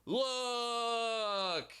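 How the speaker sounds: put-on shrill high "Look! Look!" like a tattling kid; mock a whiny accuser pointing and crying "Look! Look!"; mock tattletale. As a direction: whine it out like a child pointing and telling on someone. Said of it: "keep pointing to him going".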